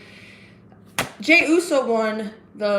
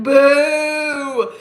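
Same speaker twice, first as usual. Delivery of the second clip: long, loud boo; drawn-out mock jeer; booing crowd; 4 semitones higher, 8 dB louder than usual. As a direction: imitate a jeering arena crowd. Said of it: "the crowd's like".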